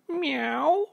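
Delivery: very high cat-like meow; meowing duck. A narrator's reading. Duck answering Mabel's hello, meowing. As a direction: mew high with a rising end.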